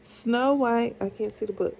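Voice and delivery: ordinary delivery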